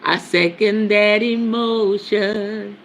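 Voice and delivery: stretched-out soulful croon. Pretend. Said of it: "In my best [name] voice".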